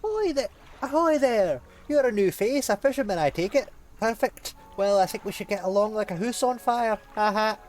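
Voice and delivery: high, loud hearty trader hailing a boat; a loud, high, beaming travelling salesman; jolly merchant voice. Acted. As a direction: boom it out like a cheery sea merchant. NPC merchant greets the fisherman.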